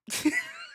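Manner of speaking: high stifled laugh; burst of laughter. Real, not acted. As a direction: laugh breaking through. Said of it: "I'm trying not to laugh".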